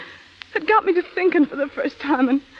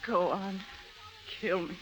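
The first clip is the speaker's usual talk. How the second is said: much softer, lower dare; hollow, tired challenge; soft weary defiance; quiet despairing dare; 7 semitones lower, 14 dB quieter than usual. Acted. Woman dares him, despairing.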